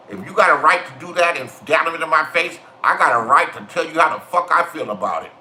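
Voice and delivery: ordinary talk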